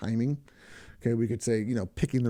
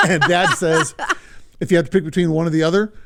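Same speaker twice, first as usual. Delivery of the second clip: talking through a laugh, louder and higher; amused laughter; 5 semitones higher, 7 dB louder than usual. Real, not acted. Podcast host retells a dad's question to his kid, laughing. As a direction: push the words out while laughing.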